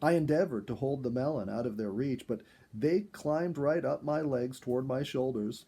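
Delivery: ordinary delivery, nothing marked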